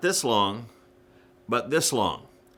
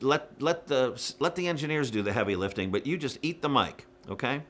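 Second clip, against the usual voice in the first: ordinary talk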